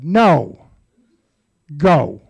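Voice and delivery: a loud, commanding voice from nowhere; the voice he heard at the door, loud and firm; mysterious inner voice. Retold or imitated. Inner voice ordering him to leave, loud and commanding. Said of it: "I hear this voice again".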